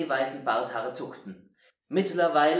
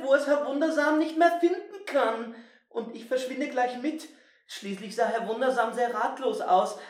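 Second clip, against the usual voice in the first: put-on high, fretting boy voice; anxious boy; 11 semitones higher than usual. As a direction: worry aloud like a scared boy. Winnie's anxious thoughts.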